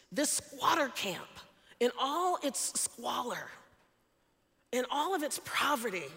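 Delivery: usual speaking voice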